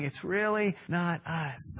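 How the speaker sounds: "It's really not us" dropped soft and slow, "really" leaned on; soft, slow repeat. Shown as a demonstration. Preacher repeats the point to the church, soft and slow. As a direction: let the line settle quietly.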